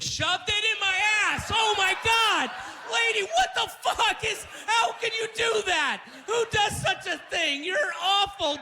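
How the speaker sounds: high, loud outraged yelling; shouting outrage at the nurse; shocked shout. Retold or imitated. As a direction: scream it in mock horror.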